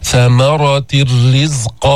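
ثمرة الرزق slow, tanween merged into a doubled راء. Shown as a demonstration. Teacher recites the Baqara example slowly. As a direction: chant slowly, the نون disappears into the راء. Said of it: "شددنا الراء وذهبت النون".

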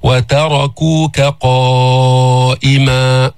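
وتركوك said very slowly with a light, unthickened ت. Shown as a demonstration. Teacher models وتركوك قائما, very slowly. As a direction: keep the ت in وتركوك thin. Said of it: "انتبه لا تفخم التاء".

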